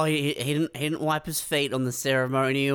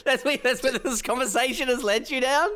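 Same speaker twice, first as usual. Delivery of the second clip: laughing disbelief; 12 semitones higher than usual. Real, not acted. Mocking a banal overheard chat, laughing. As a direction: laugh through the mockery.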